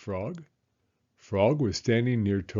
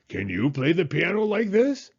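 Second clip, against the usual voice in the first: higher showing-off question; boastful Toad; 7 semitones higher, 5 dB louder than usual. Acted. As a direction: show off to a friend. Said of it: "cried Toad".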